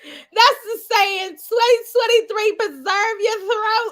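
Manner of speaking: laughing mock proclamation. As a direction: crack up while shouting the motto. Laughing shared motto.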